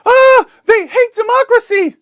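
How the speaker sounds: high shrieking mock scream; hammed-up high panicked yell. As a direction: shriek it like a hysterical reporter. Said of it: "goes back to the Taliban and screams".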